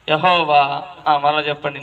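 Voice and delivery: normal talking voice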